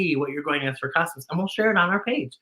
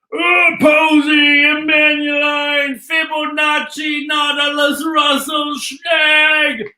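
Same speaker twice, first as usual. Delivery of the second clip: calling out; 8 semitones higher, 4 dB louder, much slower than usual. Acted. Calling out full names.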